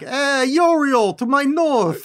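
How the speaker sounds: put-on slow, loud Italian mobster accent; hammed-up Italian mobster voice. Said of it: "I wish I could do an Italian accent".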